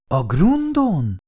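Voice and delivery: high, slow wondering echo; can't believe the word; astonished. Acted. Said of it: "Csodálkozott Szabóné". (csodálkozó, elnyújtott visszakérdezés)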